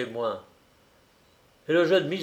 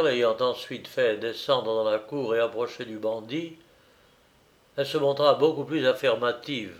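usual speaking voice

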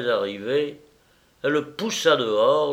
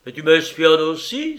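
raised, louder indignant question; exclaiming; 5 semitones higher, 7 dB louder than usual. A narrator's reading. Baron accusing his mistress of spying, exclaiming. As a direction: cry out an accusation, pitched up. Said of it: "s'écria-t-il".

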